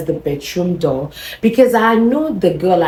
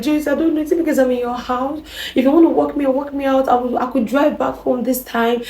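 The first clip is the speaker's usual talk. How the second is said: higher, wounded pleading voice; a higher, wounded girlfriend offering to leave; hurt girlfriend's voice; 9 semitones higher than usual. Retold or imitated. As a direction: voice her as hurt and defensive.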